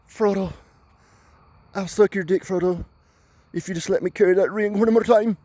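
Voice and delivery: high-pitched parody of a hobbit begging; mock Samwise plea. Sam begging Frodo for the ring in a spoof, high mock plea. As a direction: plead in a silly high, put-on voice.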